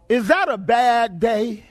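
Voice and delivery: slow, drawn-out question to the congregation; drawn-out rhetorical question. Real, not acted. Preacher asks congregation about a drowning day, slow and emphatic. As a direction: stretch it out for emphasis.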